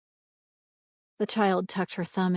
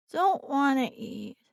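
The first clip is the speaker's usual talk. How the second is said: put-on high, slow sulky child; sleepy whiny child; 7 semitones higher, slower than usual. A narrator's reading. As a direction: whine it drowsily.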